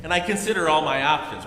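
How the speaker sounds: ordinary talk, nothing marked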